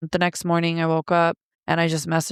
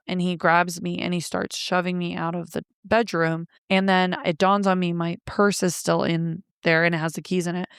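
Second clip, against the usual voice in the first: ordinary talk, nothing marked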